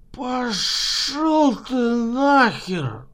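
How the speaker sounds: put-on slow slurred drunken grumble; town drunk. A narrator's reading. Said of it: "Пьяно заворчал".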